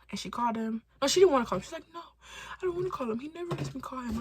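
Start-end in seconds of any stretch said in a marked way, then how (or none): 1.9-4.2 high-pitched whiny protest; protesting friend. Retold or imitated. Scared friend refusing to call him, high protest. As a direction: whine it high like a reluctant friend.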